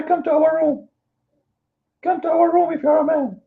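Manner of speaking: mock them with a high whining taunt; mocking whiny cry. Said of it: "they cry".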